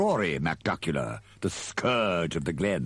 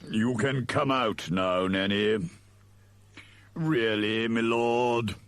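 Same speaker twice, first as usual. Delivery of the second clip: slow, drawn-out butler voice; long-suffering and deliberate; peeved servant; 5 semitones higher, slower than usual. A narrator's reading. Igor to Nanny and his lord, slowly peeved. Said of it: "still looking rather peeved".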